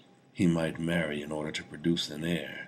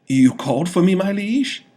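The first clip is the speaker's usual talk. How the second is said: high, loud, jittery servant's voice; a twitchy vizier stammering to his king; nervous stuttering vizier; 13 semitones higher, 11 dB louder than usual. A narrator's reading. Vizier answers the king in a high nervous voice. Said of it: "the vizier stuttered as usual".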